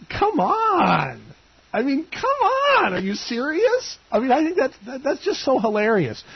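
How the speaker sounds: high, disbelieving exclamation; can't believe it, laughing it off; incredulous. Real, not acted. Host incredulous at voicemail.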